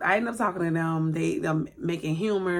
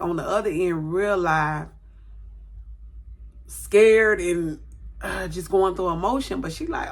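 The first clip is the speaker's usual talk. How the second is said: usual speaking voice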